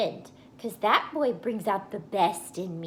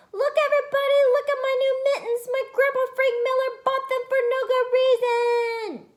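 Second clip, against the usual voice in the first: put-on high, bright-eyed kid shouting to the whole playground; excited child calling out; 9 dB louder, faster than usual. Junie showing off her mittens to the playground, excited high call. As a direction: show off the new mittens to everybody, high and loud.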